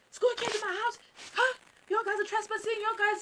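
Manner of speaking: high-pitched mock scolding; scolding mother. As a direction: imitate an indignant mom confronting intruders.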